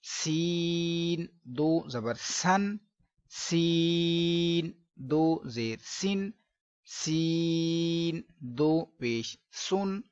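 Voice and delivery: slow drill with light thin सीन; thin seen. Shown as a demonstration. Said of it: "बारीक सीन को पढ़ेंगे".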